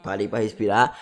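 strained, choked-up throat; talking through a sore throat. Real, not acted. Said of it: "tô engasgado aqui agora porque eu tô com a garganta inflamada".

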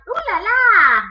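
high, loud playful cry; shout. Acted. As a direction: squeal it out for the kids.

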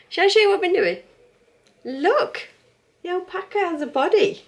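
high, loud and bubbly; excited. Real, not acted. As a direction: show it off with bright delight. Showing off her crochet.

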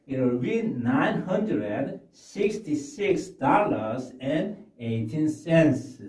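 very slow full form; slow model answer. Shown as a demonstration. Teacher modelling the price slowly for learners. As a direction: say every number slowly. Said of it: "teacher models English answer".

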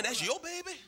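very high, disbelieving question; incredulous question. Acted. Onlooker questioning the mother about her baby, incredulous. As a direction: squeak it in mock disbelief.

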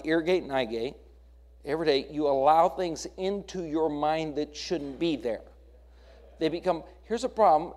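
usual speaking voice

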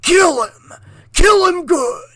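high, loud savage croak; gleeful croaking taunt. A narrator's reading. Monster urging the girl to kill Jesse, loud wicked croak. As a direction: egg her on with wicked glee. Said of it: "he croaked".

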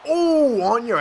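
high, loud whoop; excited exclamation. Real, not acted. Player seeing the opponent knocked down, excited. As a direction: burst out as the hit lands. Player reacts to landed hit.

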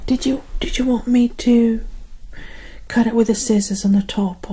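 normal talking voice

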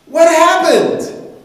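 high, loud, slow; demanding question. Acted. Wife demands of the healed man, loud and high. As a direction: press her for the answer.

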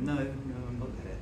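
soft, hushed reply; still quiet voice. Retold or imitated. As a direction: answer gently, barely above a breath. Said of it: "still, quiet voice".

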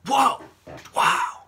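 sudden high, loud whoa; jolted by something unexpected; startled exclaim. Real, not acted. Startled by something mid-vlog, loud high exclaim.

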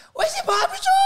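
high-pitched excited fan yell; imitated fan shout. Streamer mimics fans spotting them at the mall, high mock shout. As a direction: squeal it out like a fan spotting someone.

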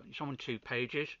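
ordinary talk, nothing marked

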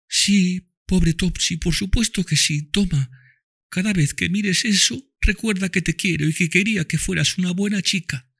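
put-on high child voice, tender; dying girl speaks lovingly to Tom and Mami, high child voice; loving little girl. A narrator's reading. Eva comforts Topsy.

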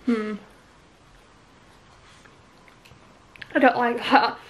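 talking while chewing food; muffled through food; mouth full chewing. Real, not acted. Seen 0: Bites pancake at -3.0; closed mouth working, finger at lips.

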